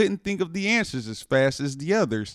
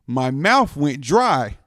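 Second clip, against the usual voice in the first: usual speaking voice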